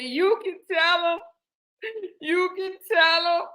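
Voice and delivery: high, pleading mock-crying voice; imitated tearful plea. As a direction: pitch it up as if crying. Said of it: "I'm crying".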